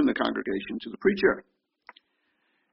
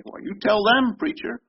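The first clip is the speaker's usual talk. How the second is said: smug call-out; 8 semitones higher than usual. Retold or imitated. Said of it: "we can say".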